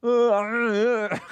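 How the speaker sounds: high, loud creature roar; short amateur Wookiee cry; Chewbacca. Shown as a demonstration. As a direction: wail like a big furry beast. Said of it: "And then my Chewbacca impression".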